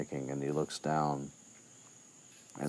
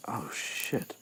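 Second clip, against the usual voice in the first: hushed dread; 5 semitones higher, 6 dB quieter than usual. Retold or imitated. Retold reaction to seeing the Grim.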